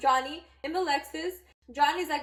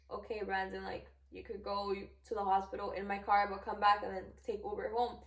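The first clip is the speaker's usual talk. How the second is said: low, soft, resigned agreement; reluctant giving in; 4 semitones lower, 6 dB quieter than usual. Retold or imitated. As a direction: give in grudgingly, voice dropped low. Reluctantly gives in to Brandon.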